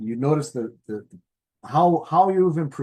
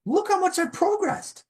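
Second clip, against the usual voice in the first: much higher, wide-eyed wow; amazed at progress; 11 semitones higher than usual. Retold or imitated. Looking back on your progress, amazed and higher. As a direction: marvel at how far you've come. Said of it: "you go".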